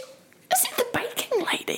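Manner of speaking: high squeal of recognition; squeaky awestruck voice. Pretend. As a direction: gasp like a starstruck fan.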